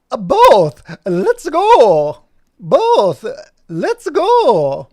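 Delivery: high, very loud strained cry; squeaky Mario-like voice. Acted. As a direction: yelp it like Mario kicked in the groin. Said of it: "sound like Mario has been kicked".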